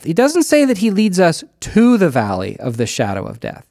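usual speaking voice

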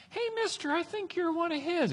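put-on tiny, very high child voice; little girl voice. Little girl questions Peter, high soft child voice. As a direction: squeak it softly like a small girl. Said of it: "this little girl comes up".

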